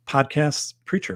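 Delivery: normal talking voice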